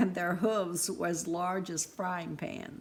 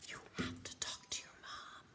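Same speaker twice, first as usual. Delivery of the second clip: unvoiced hush off the phone; whispered; 13 dB quieter than usual. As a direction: whisper so the mother can't hear.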